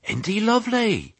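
high, cooing sheep voice; admiring murmur. A narrator's reading. Sheep admire the polite piglet, cooing murmur. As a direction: coo it high, quite charmed. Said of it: "changed to murmurs of".

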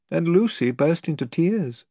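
plain reading voice

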